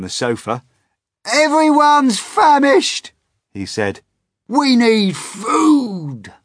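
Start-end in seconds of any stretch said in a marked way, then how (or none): 1.2-3.1 very high, loud, squawking centipede. Acted. Centipede complaining to the others, very high and loud. 4.5-6.3 very high, loud, slow whine; whining centipede. Acted. Centipede demanding food from everyone, high loud wail. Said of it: "centipede demands food".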